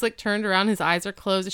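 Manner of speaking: normal talking voice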